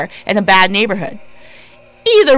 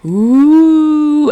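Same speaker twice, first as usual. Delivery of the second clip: spooky ooh; 6 semitones higher, 4 dB louder than usual. Pretend. Spooky sign-off sound.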